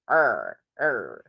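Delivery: "Er" with a heavy country R. Shown as a demonstration. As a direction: hold a low, curled country R on "Er". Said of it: "have the R in country".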